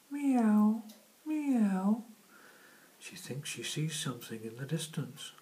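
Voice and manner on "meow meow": high, loud meowing; cat meow. Acted. Cat meows.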